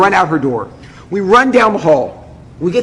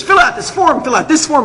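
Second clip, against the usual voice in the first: urgent ordering; 8 semitones higher than usual. Retold or imitated. Acting out the frantic clerk.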